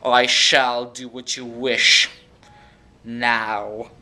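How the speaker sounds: slow, drawn-out mock evil voice. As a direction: stretch it out like a creepy villain. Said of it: "He had, like, a really evil voice".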